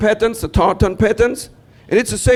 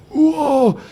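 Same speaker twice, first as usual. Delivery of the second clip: higher amazed whoa; childlike amazement; 8 semitones higher than usual. Retold or imitated. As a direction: gasp in wonder as a small boy.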